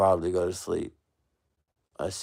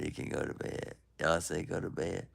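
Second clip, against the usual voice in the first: drowsy, half-asleep muttering; sleepy mumble; 3 semitones lower, 8 dB quieter than usual. Real, not acted. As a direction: mumble it as if dozing.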